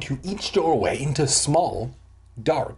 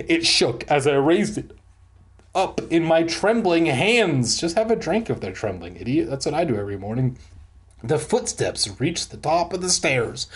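normal talking voice

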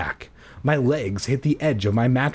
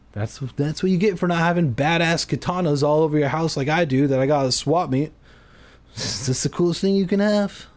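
fast, higher bragging aside; mock boast; 5 semitones higher, faster than usual. Real, not acted. Reader jokes to listeners about his katanas, fast mock boast. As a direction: brag about swap meet katanas. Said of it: "comic aside about katanas".

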